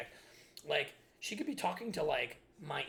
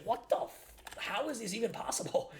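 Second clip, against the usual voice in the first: incredulous; 7 semitones higher than usual. Retold or imitated. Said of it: "it's like".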